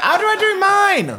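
very high, pleading question; high squeaky plea. Retold or imitated. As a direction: squeak it like a lost beginner. Mocked self in kitchen.

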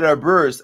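"Abuse" said as uh-BURSE; mimicked mispronunciation. Host repeats what the fan said, mimicked mispronunciation. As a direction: imitate the fan's "Abuse" with an r, uh-BURSE. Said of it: "And then she said".

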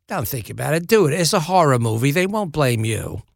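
offhand mock-Cockney; imitated Cockney, casually reassuring; Michael Caine. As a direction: deliver dry advice as a rough London star impression. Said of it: "This is a terrible Michael Caine impression".

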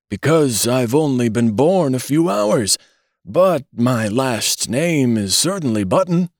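a lightly voiced old man's high whine; querulous whine. A narrator's reading. Old newborn answering his father, lightly voiced querulous whine. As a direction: whine back like a fussy old man. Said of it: "replied the querulous whine".

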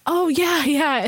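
ordinary talk, nothing marked

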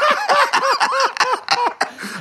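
big high-pitched laugh; high cackle. Real, not acted. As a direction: burst into a squealing laugh. Laughs after keys joke.

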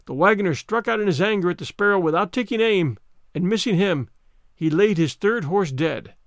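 usual speaking voice

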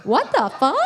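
mock-baffled onlookers, pitch swooping; hammed-up confusion; baffled reaction. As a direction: react in exaggerated disbelief. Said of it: "everyone else is gonna be like".

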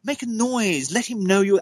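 high-pitched goading of a ghost; retold high summons, not truly shouted; mock shouting to spirits. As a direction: egg the spirits on in a high, calling voice. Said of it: "shouted out".

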